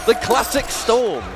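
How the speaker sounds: high, slow, shouted hype; excited hype call. Real, not acted. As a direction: call it out like the crowd's on its feet. Hype call after a big combo.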